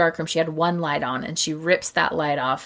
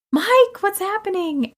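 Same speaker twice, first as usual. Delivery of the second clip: scared call. Retold or imitated. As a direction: call the husband's name in fright, high-pitched. Frightened blind wife calling out.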